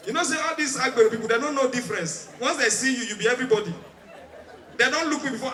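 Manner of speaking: usual speaking voice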